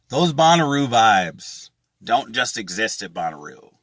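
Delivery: usual speaking voice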